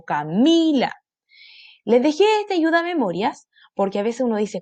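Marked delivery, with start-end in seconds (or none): 0.0-0.9 Camila with stress on mi. Shown as a demonstration. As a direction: lean on mi in Camila. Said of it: "me llamo".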